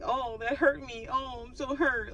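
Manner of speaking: high, whiny mock complaint; hammed-up self-pity, high; exaggerated whining. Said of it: "over-exaggerating the pain, the hurt".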